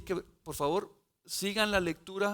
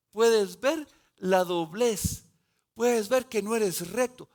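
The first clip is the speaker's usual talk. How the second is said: forceful preaching; 3 semitones higher, 7 dB louder, slower than usual. Acted. Preacher voicing God's blessing.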